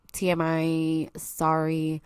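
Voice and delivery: normal talking voice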